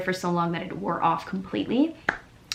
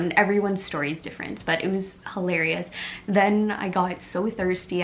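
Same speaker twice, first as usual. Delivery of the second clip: ordinary talk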